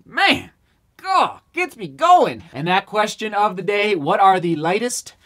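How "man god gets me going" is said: high, slow, relishing delight; fired-up. Real, not acted. Runner thrilled by the light shoe, slow and high. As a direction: savor it like it thrills you.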